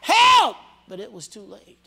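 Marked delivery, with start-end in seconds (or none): 0.0-0.5 high, loud desperate cries of help; screaming for help. Retold or imitated. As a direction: scream help as the flood hits. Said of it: "They were screaming".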